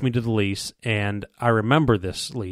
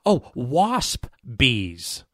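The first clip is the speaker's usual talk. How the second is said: slow realization; much slower than usual. Retold or imitated. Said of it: "I'm like".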